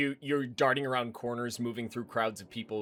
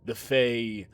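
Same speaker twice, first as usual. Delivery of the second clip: menacing hard man. Acted. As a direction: let the menace sit under calm words. Man threatens the priest.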